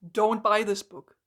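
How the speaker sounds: normal talking voice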